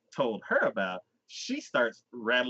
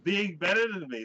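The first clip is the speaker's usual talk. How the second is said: high-pitched whiny sulk, retold; mock petulant protest; pouty; 10 semitones higher than usual. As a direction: act out a wounded complaint, voice pushed up. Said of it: "he starts getting pouty".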